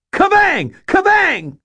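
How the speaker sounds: very high, loud scream; screamed incantation. Acted. Teen wizard screams his spell at the liquor bottles. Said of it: "and screamed".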